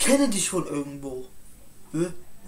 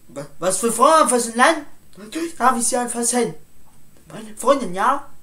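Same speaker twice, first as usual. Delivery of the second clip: shouted indignantly, much louder and higher; angry shout; 3 semitones higher, 7 dB louder than usual. Acted. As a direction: bark it back, outraged. Angry reply to accusation. (empört geschrien)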